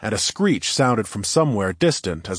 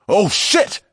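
loud, higher startled curse; alarmed exclamation; 11 semitones higher, 6 dB louder than usual. Acted. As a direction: blurt it in sudden alarm. Sudden danger exclamation.

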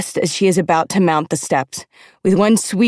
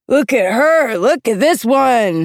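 higher, swooping, drawn-out holler; hollering drunk; 6 semitones higher, 4 dB louder, slower than usual. A narrator's reading. Drunken mentor showing off the volunteer, hollering. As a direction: bellow it boozily to the crowd. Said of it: "he hollers".

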